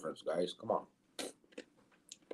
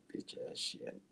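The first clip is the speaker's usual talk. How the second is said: whispered swearing under breath; muttered curse; 18 dB quieter than usual. Real, not acted. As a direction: grumble it to yourself. Frustrated at screen.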